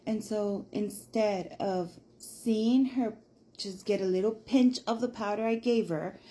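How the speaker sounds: ordinary talk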